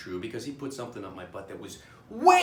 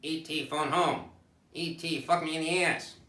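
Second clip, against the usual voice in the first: movie alien impression; 4 semitones higher than usual. Comic mocking fear of movies, movie alien impression. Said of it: "who's afraid of movies? It's like".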